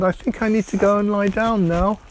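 too overwhelmed to cope; dazed little boy. Acted. Shocked Timmy wants to rest.

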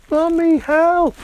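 put-on a frightened child calling upstairs; scared call. Timmy scared, calls his mother.

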